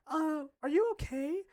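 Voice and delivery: slow, high-pitched mock concern; high, drawn-out teacher voice. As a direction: imitate a teacher gingerly checking on someone.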